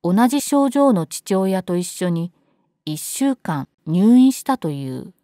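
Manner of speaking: plain reading voice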